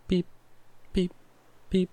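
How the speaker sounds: short imitated monitor beeps; heart monitor beep. Shown as a demonstration. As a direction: mimic a hospital monitor's beep. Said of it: "heart rate monitors you see in hospitals that are like".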